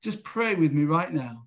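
normal talking voice

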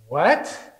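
incredulous rising "What?"; drawn-up questioning disbelief; mock astonishment. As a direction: imitate a baffled stranger. Shopper's astonished reply.

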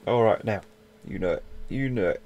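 ordinary talk, nothing marked

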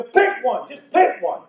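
mock exasperated order; exasperated grandpa. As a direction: insist on it again, losing patience. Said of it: "And I go".

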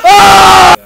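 sharp high panicked yell; startled high scream. Real, not acted. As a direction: scream as the witch hits. Witch attack in game.

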